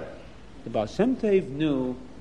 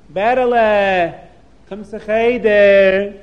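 put-on slow, louder call to a child; 3 semitones higher, 9 dB louder, slower than usual. Rebbe calling at the door for the boy, calling out.

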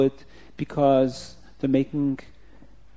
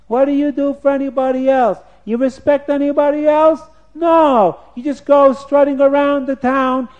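put-on high child voice, getting louder; little kid; 12 semitones higher, 9 dB louder than usual. Little kid challenging the self-important officer, high defiant voice. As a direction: challenge him like a cheeky kid. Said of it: "Now, it's a little kid".

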